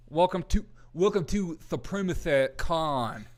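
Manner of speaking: slow, drawn-out announcer welcome; mock grand welcome. Welcoming friends to the made-up convention, slow mock announcer. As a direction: roll out the name like a big convention host. Announcing the group's new name.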